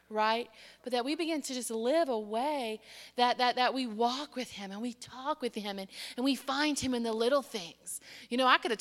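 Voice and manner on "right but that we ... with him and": usual speaking voice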